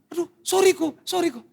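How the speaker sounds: high, sheepish helper voice; apologetic helper. Retold or imitated. Helper apologizing to the pastor, high sheepish voice. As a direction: squeak out a guilty apology. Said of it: "Dia bilang".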